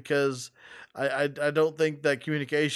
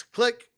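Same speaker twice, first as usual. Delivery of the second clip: high, loud spoken click; 8 semitones higher, 4 dB louder than usual. Retold or imitated. Imitating hanging up on a caller, crisp spoken click. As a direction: say click like the phone slamming down.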